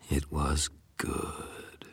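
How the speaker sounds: very slow, drawn-out whisper; savouring whisper. A narrator's reading. Wolf narrator relishing the deadly fight, savouring whisper. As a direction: whisper it, stretching each word with relish.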